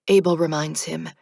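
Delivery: usual speaking voice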